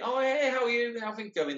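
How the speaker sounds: bright, high, loud friendly greeting; cheery hello. Retold or imitated. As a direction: greet him like any old mate, high and chirpy. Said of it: "instead of saying".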